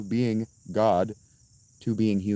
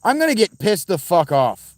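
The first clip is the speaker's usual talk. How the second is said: loud, raised, pissed-off outburst; angry shout; 8 semitones higher, 8 dB louder than usual. Real, not acted. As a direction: blow up in real frustration. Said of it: "I'm sorry that I shouted at you".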